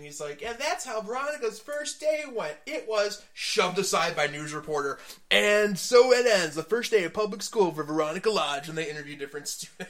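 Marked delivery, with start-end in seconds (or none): 0.4-8.7 loud, dramatic recap narration; big stagey narrator voice; Archie narrating. Acted. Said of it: "Where Archie is narrating".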